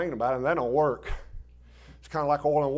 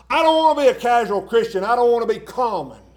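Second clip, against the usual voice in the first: loud, pleading retelling of a prayer; loud plea; 8 semitones higher, 8 dB louder than usual. Retold or imitated. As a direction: cry it out to God with fervour. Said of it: "I said".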